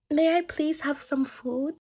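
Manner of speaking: high, slow, sweet little request; imitated girl begging daintily; pleading girl. Girl politely begs for food.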